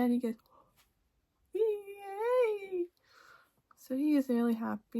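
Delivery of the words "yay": high, drawn-out little cheer; excited cheer. Retold or imitated. Boyfriend hears she is pregnant, high excited cheer. Acting boyfriend's happy reaction.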